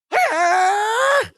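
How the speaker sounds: high, sudden mock kiai; hammed-up karate cry; karate yell. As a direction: leap into a fake karate shout. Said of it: "suddenly Larry goes".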